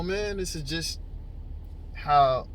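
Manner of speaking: usual speaking voice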